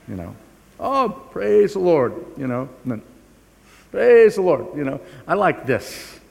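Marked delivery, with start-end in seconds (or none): 0.8-2.1 joyful praise. Retold or imitated. Mock joyful worship exclamation. 3.9-4.8 joyful praise. Retold or imitated. Mock joyful worship exclamation.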